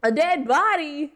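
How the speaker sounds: shocked, high and slow; surprised. Real, not acted. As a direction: stretch it out in disbelief. Rereads line after hiccup.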